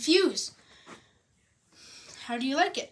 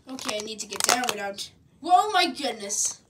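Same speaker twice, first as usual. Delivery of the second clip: ordinary talk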